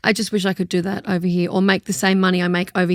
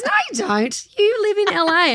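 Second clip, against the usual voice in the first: higher, flatly insistent denial; insistent fan; 9 semitones higher than usual. Retold or imitated. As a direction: contradict her like you know better. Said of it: "she goes".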